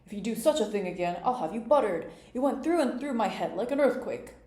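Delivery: loud, climbing royal scolding; outraged, emphatic king's threat; indignant king. A narrator's reading. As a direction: scold loudly, pitch rising as the complaint builds. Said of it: "cried the poor king".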